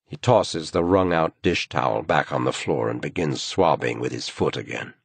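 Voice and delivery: ordinary delivery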